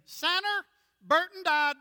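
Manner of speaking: high, loud Southern grandma call; mamaw's voice. Retold or imitated. Mamaw announcing a death on the phone, high and loud. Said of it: "my Mamaw's voice saying".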